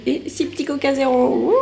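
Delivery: high, loud celebratory rise into a whoop; playful excitement. Real, not acted. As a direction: cheer it like the haul's big treat. Cheers her Coke Zero in grocery haul. (excitation joyeuse, voix aiguë)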